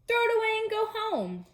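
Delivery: high mean robin taunt; jeering robins. Acted. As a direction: squawk it down at Toad like a nasty little bird.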